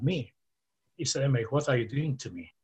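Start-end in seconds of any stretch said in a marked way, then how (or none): none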